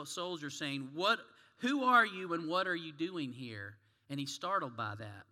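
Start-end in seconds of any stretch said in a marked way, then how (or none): 0.9-3.8 loud, demanding soldier's question; barked challenge. Acted. Russian soldier startles a wandering rabbi, barking loudly. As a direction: challenge an intruder forcefully. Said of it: "again, the soldier barked out".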